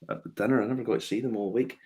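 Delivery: usual speaking voice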